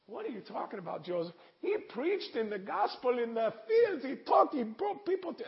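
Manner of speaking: very high, loud voice of disbelief and praise; excited exchange. Retold or imitated. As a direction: relay the pastor's breathless praise. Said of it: "And I".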